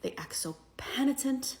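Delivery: usual speaking voice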